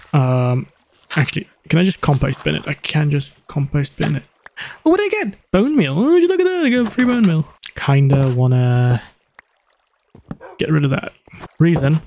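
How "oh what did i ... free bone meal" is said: high, thrilled squeal of delight. Real, not acted. Thrilled at getting free bone meal, squealing. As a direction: squeal with glee, pitch way up.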